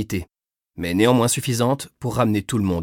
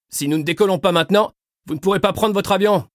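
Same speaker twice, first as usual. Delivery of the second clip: urgent raised voice; shouting; 11 semitones higher than usual. A narrator's reading. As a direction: shout it over the engine. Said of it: "lui cria le pilote".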